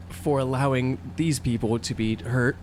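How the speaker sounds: ordinary talk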